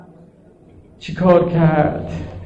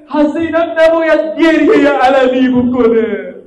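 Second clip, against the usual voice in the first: preaching at full force; 9 semitones higher than usual. Real, not acted. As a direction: preach it out with rising passion. Rising fervent preaching. (موعظهٔ پرشور با صدای بلند و زیر)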